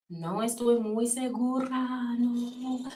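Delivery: a touch of a hesitant abuela backing off; quiet, slow, half-hearted answer; unsure grandma. Retold or imitated.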